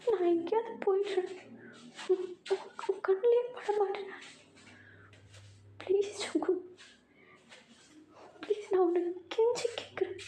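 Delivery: pleading victim. Acted. Tae voiced high, pleading. (உயர்ந்த குரலில் கெஞ்சும் டே)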